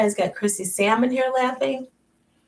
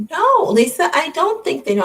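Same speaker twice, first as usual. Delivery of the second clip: high, loud mock denial; sing-song fake innocence; playful denial; 9 semitones higher, 7 dB louder than usual. Real, not acted. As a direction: tease her with a big high "no". Teasing denial to Lisa.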